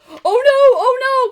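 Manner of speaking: high, very loud alarmed buffalo; frightened cry. Acted. Buffalo begging the elephant not to sneeze, loud panicked cry. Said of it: "cried the buffalo".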